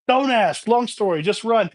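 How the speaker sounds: urgent urging. Retold or imitated. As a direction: rush him along, half-yelling. Friends urging Doug to flee.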